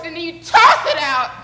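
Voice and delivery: loud burst on the toss; effortful heave. Pretend. Leader miming throwing a heavy net, loud heave. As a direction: throw your whole body into TOSS.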